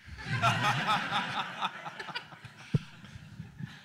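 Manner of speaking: high breathy burst of laughter; real laugh. Real, not acted. Panelist laughing after telling the Cuba accent story, real laughter. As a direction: laugh at your own punchline.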